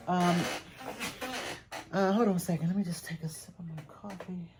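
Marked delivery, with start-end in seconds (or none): 2.7-4.5 breathy whisper, barely voiced; whispered aside. Real, not acted. Seller whispers to herself, pausing the haul for coffee, in a whisper. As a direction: whisper it as a quick aside to yourself.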